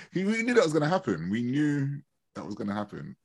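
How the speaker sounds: ordinary talk, nothing marked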